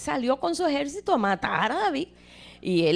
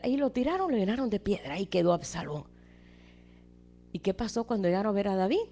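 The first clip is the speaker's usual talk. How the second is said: normal talking voice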